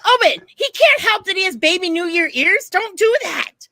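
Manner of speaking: high, loud telling-off; scolding shout. Retold or imitated. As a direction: scold the wrestler from the stands. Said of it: "I'm like".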